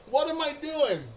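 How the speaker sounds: high, laughing self-mockery; amused squeal of disbelief; laughing at himself. Real, not acted. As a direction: ask it through a giggle. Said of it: "laughs at himself".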